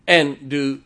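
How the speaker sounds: loud, high, very slow preaching; full-force preaching. Acted. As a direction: hammer each word from the pulpit.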